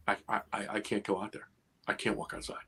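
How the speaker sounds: halting, very soft admission; hushed, stammering start, as if frozen; retold frozen fear. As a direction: relive the dread, voice sinking almost to nothing. Reliving being frozen with fear.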